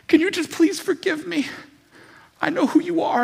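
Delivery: a high, loud plea for mercy; pleading thief. Acted. Thief on the cross begging Jesus, high loud plea. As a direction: beg like the dying thief.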